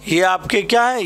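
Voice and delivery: usual speaking voice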